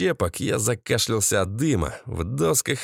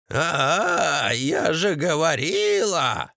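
drawn-out, higher gloating cry; triumphant mother-in-law's wail; 3 semitones higher, much slower than usual. A narrator's reading. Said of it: "Торжествующий вопль тёщи". (торжествующий вопль тёщи)

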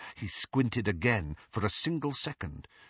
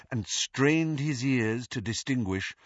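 ordinary delivery, nothing marked

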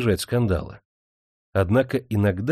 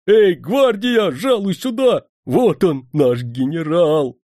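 loud, high, drawn-out call; shouting; 7 semitones higher, 5 dB louder, slower than usual. A narrator's reading. Servant summoning the courier to his master, shouting. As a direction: call out proudly to the guardsman. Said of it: "закричал слуга".

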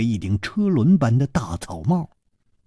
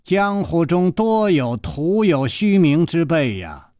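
ponderous, ringing giant's voice; slow, booming voice; 4 semitones higher than usual. A narrator's reading. Giant answering his small partner, slow booming voice. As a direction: draw each word out, resonant as a great bell. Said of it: "却如洪钟大吕".

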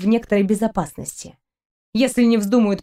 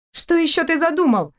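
higher, outraged question; indignant young woman, pitched up; 7 semitones higher than usual. A narrator's reading. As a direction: bristle at him. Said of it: "возмутилась она".

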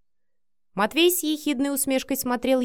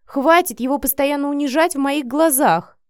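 loud, raised-pitch outburst; losing her temper; 5 semitones higher, 5 dB louder than usual. A narrator's reading. Vika defends the man to her friend, angry outburst. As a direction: burst out at her, fed up. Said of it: "вывели Вику из себя".

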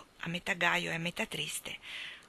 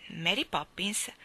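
ordinary delivery, nothing marked

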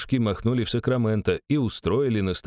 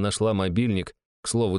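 usual speaking voice

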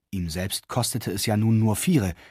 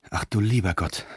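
leise, tiefere, innige Klage; innerlich seufzend; 3 semitones lower than usual. A narrator's reading. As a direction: ein Seufzer aus tiefster Seele, gedämpft und tiefer. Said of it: "seufzte Reichardt recht innerlich". (leise und tiefer geseufzt, ganz nach innen)

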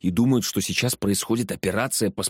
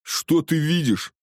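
higher, slow demanding cry; raised, insistent yell; shouting; 7 semitones higher, slower than usual. A narrator's reading. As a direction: demand an answer from the captive. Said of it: "крикнул амбал".

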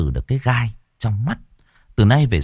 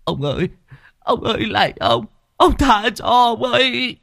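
whimpering plea; 14 semitones higher than usual. A narrator's reading. Beaten servant begging the master for mercy, whimpering. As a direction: plead for mercy, near tears. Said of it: "miệng không ngừng mếu máo".

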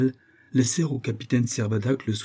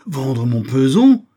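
high, loud, aggrieved protest; indignant outcry; 7 semitones higher, 7 dB louder than usual. A narrator's reading. Usurer fearing to be stripped, indignant outcry. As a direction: protest as if being robbed. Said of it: "s'écria Isaac Akabut".

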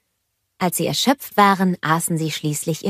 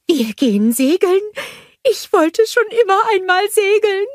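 much higher, louder bubbly snowman voice; cheerful snowman Olaf; 5 dB louder than usual. A narrator's reading. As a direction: bounce up to the kids full of joy. Olaf thrilled about sailing.

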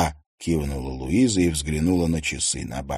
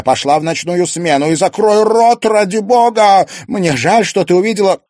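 higher and louder, pressing; urgent pleading; 13 semitones higher, 8 dB louder than usual. Acted. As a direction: beg her to shut up. Mother pleading to hush.

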